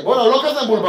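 ordinary talk, nothing marked

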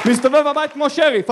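ordinary delivery

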